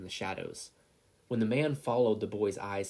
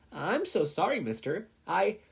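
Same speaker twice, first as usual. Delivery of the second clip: put-on cheerful apologetic boy; 9 semitones higher, 4 dB louder than usual. A narrator's reading. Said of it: "lack of fear".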